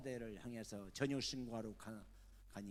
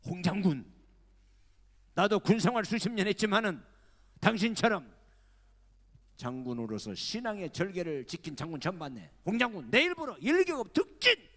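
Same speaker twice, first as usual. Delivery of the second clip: high, loud, booming praise; hearty welcome; 8 semitones higher, 12 dB louder than usual. Retold or imitated. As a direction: greet him with open arms. President warmly greets general.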